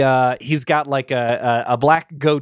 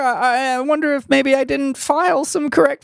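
pitched way up, wistful little fairy; high fairy voice; 11 semitones higher than usual. Acted. GM redoing the fairy's line in the right high voice. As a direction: redo it high and sighing.

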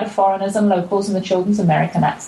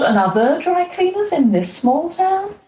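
cleaners stretched out, much higher in disbelief; mock disbelief; 10 semitones higher than usual. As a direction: act amazed there's yet another one. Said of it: "I thought".

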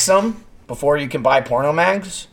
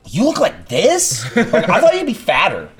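higher, drawn-out mock astonishment; slow, raised-pitch disbelief; imitated disbelief; 6 semitones higher than usual. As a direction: act out a stranger's incredulous reaction. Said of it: "every person I met early on".

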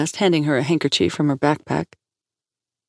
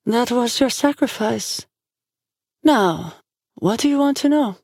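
oracle character; 8 semitones higher than usual. A narrator's reading. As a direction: speak as a solemn oracle, pitched high. Oracle character voiced in audiobook.

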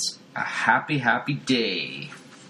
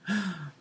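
higher, soft hammed-up gasp; a quiet theatrical intake of breath; mock gasp; 8 semitones higher, 6 dB quieter than usual. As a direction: gasp in sitcom shock. Wife shocked.

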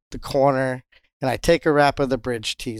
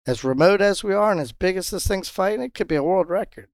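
higher-pitched playful speculation; joking; 7 semitones higher than usual. Retold or imitated. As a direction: toss out a big claim in jest. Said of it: "I jokingly said".

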